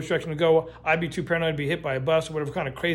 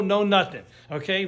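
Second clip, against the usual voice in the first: ordinary talk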